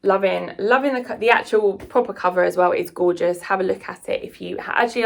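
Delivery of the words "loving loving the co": ordinary delivery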